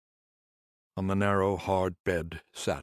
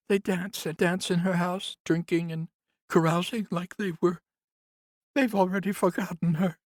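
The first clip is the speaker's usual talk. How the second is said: much higher, louder woman's voice, bitter; grieving nurse; 13 semitones higher, 4 dB louder than usual. Acted. Nurse complains to Conrad about the house, higher voice. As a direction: lift into the nurse's aggrieved voice.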